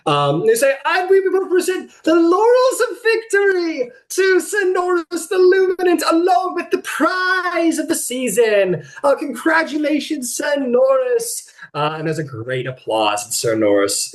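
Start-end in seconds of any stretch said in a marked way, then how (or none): 0.8-11.5 high, projected prize-giving proclamation; ceremonial announcer. Acted. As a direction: present the laurels to the whole arena. Officials present the prize.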